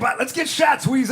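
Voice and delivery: rally the room for shots; party shout. Pretend. Host hyping shots with Wheezy, high party shout.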